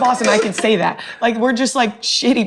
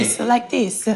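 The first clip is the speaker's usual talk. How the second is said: Italian woman impression; 2 semitones higher, 4 dB quieter, slower than usual. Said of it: "can you do your best Italian woman act".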